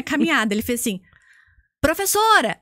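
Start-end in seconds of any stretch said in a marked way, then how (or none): 1.8-2.6 imitating an excited guesser calling out, high-pitched; mock call-out of a sudden guess; eager guess. Stranger tries to guess her job, eager guess.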